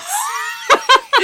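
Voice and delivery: shrieking laugh. Real, not acted. Laughing at own quote.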